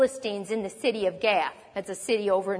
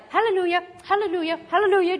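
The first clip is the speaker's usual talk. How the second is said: higher, sing-song routine hallelujahs; religious cliche; 7 semitones higher, much faster than usual. Retold or imitated. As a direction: toss them off like a hollow habit. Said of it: "don't hear it like".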